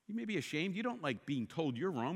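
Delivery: ordinary talk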